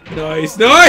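loud excited cheer. Real, not acted. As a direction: cheer the hit loudly. Cheering a hammer kill.

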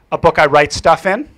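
loud, blunt reply; curt answer. Retold or imitated. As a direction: state it like it's obvious. Girl answers curtly.

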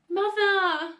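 high, loud drawn-out "Mother"; stretched, panicky cry; horrified wail. A narrator's reading. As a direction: wail to her in dismay. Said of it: "wailed Missus Cranky".

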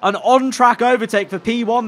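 high, loud, thrilled play-by-play; excited race commentary. Real, not acted. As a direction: call the overtake at full excitement. His driver takes the race lead.

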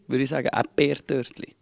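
normal talking voice

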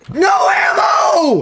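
long, high-pitched yell; loud gamer scream, very high. Retold or imitated. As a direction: holler it out, stretching both words. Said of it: "Just be like".

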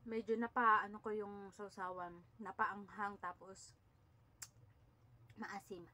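talking around a handful of rice; food held at lips. Real, not acted. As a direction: lips touching food while speaking. Seen 1: Hand holds rice ball against her lips while talking throughout.

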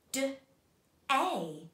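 "D-A" said as separate sounds; sounding out. Shown as a demonstration. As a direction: sound out "D-A" piece by piece for learners. Teacher sounds out the word.

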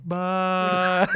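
high, put-on little voice; little kid drifting away. Boy carried downriver past his parents, small high goodbye.

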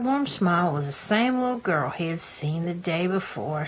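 ordinary delivery

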